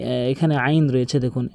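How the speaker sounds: ordinary talk, nothing marked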